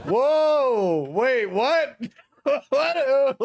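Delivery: high, loud Whoa, wait, what?; real shock. Real, not acted. Streamer stunned by the presenter's joke, loud and high.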